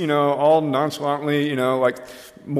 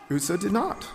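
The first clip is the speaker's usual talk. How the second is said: usual speaking voice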